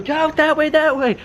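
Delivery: high-pitched mock holler; calling out. Hand out the window directing Groms, high calling out. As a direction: re-enact calling directions from a car.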